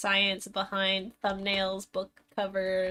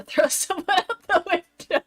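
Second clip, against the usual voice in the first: giggling through the words; laughing; 9 semitones higher, 4 dB louder than usual. Real, not acted. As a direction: laugh while you explain. Explaining word while cracking up.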